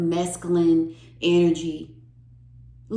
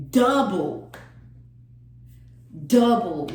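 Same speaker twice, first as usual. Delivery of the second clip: "Double" punched loud and falling; emphatic repeat; 5 dB louder than usual. Real, not acted. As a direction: hammer the word "Double" each time.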